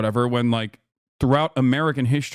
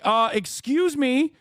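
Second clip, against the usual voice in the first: high, whiny mock indignation; entitled complainer; slower than usual. Mock complainer demanding Monet make art just for him, high and affronted. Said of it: "can you imagine being like".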